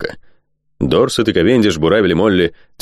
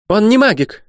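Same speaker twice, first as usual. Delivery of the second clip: very high, sharp objection; loud, sharp interruption. A narrator's reading. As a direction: cut in sharply, voice pitched far up. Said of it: "громко и резко перебила". (громко и резко, перебивая)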